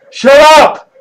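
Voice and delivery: sharp high yell at the dogs; shouting. Real, not acted. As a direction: bark it out to silence them. Yelling at the barking dogs.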